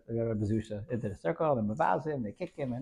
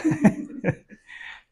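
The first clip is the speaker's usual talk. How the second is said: breathy laugh; 10 semitones higher, 6 dB louder than usual. Real, not acted. Teller laughs at story.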